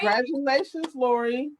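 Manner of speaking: high-pitched sing-song congrats; high cheerful congratulations. Real, not acted. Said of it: "congratulating winner".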